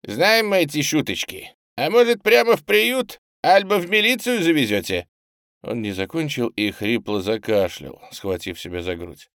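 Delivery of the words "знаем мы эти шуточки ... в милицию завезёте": high, loud, worried challenge; distrustful. A narrator's reading. Skinny boy suspecting a trip to the police, distrustful and raised. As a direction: accuse with wary scepticism. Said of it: "озабоченно недоверчиво протянул".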